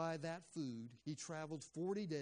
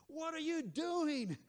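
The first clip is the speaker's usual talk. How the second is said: shrill high scream of an angry mom, much louder and higher; mother screaming; 8 dB louder, slower than usual. Retold or imitated. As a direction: yell it like a mom catching her kid drawing on the wall. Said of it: "you hear this voice from the mother screaming".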